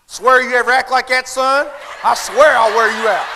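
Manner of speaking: loud mock scolding; parent's threat. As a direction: warn the kid loudly as a fed-up parent. Parent threatening child in a story.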